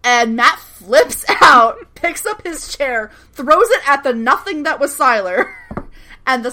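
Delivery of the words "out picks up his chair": ordinary delivery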